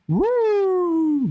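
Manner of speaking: high hooting ghost call; ghost woo. Pretend. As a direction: hoot like a spooky ghost. Playful ghost noise.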